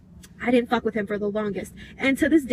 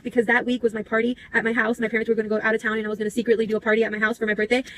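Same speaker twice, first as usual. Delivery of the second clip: ordinary talk, nothing marked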